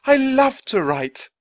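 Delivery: slow, high, loud exultation; joyful. A narrator's reading. Writer rediscovering his calling, joyful. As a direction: proclaim it with delight. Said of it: "He laughed with joy".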